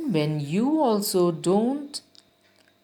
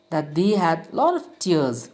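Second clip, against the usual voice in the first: ordinary delivery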